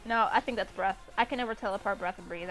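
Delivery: ordinary talk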